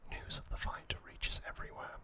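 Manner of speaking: ordinary talk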